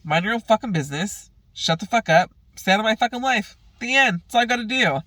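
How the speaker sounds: angry telling-off. Retold or imitated. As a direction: shut her down, final word. Retells telling mother off.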